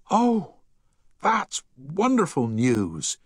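a high, bright burst of joy then slow; overjoyed gasp, drawn-out praise; mother thrilled at son's news, high and slow; delighted. A narrator's reading. Mother hears her son's good news.

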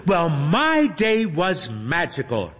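put-on high, slow, sugary girl voice; sweet streamer voice. A narrator's reading. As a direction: coo at a favourite fan.

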